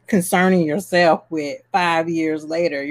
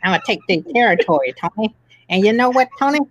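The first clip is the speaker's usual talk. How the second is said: fast gangster voice, higher pitch; James Cagney impression; 4 semitones higher, faster than usual. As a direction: tough guy bragging, see. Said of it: "That's our impression of James Cagney".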